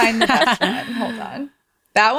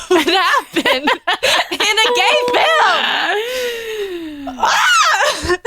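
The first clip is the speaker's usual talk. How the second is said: laughing shriek; 12 semitones higher, much slower than usual. Real, not acted. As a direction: burst out laughing at the line. Breaks into laughter.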